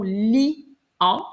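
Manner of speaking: "lion" pulled apart slowly as li...on; stretched word. Shown as a demonstration. Teacher checking the word for an A, slowly stretched. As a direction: draw out both halves of "lion". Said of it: "Et là, dans ce cas-ci".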